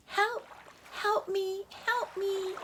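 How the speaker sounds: high small cries for help; a tiny voice calling up from the river; little calling voice. A narrator's reading. River calling to the chick, high and small. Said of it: "he heard a little voice calling".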